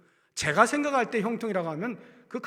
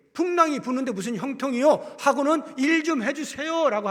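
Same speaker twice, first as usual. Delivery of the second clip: loud, high, exasperated demand; mock the disciples' frustration; indignant complaint; 8 semitones higher, 8 dB louder than usual. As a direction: disciples loudly demanding Jesus act.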